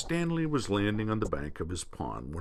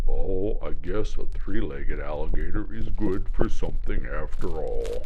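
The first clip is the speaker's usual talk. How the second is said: low, louder pleased gator grunt; grinning grunt; 3 semitones lower, 6 dB louder than usual. Acted. Gator grunts modestly to the spoonbill, pleased. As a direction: grunt it with a grin. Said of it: "Grinning, Stumpy grunted".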